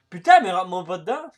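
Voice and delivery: loud, quick protest; annoyed shout. Real, not acted. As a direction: shout at the driver who just hit you. Protests being rammed by a player.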